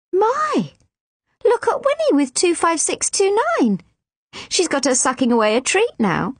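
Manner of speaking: a nurse pleasantly amazed, calling out high; delighted nurse. Acted. Nurse marvelling at Winnie's success, high and delighted.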